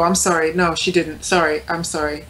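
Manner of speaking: usual speaking voice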